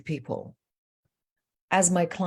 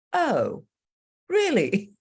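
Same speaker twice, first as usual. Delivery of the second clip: imitating the daughter, pitched higher in mild surprise; a light, lifted "really?" caught off guard; surprised daughter; 8 semitones higher, much slower than usual. Daughter answering her mother, higher in mock surprise. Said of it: "And the daughter said".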